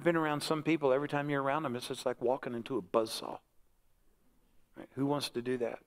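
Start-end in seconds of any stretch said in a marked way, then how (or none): none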